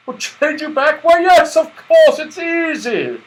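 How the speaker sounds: high, loud cheerful reassurance; jolly Santa. A narrator's reading. Santa cheerfully assuring Joe, booming jolly voice. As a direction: laugh off the worry merrily. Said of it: "said Santa Claus".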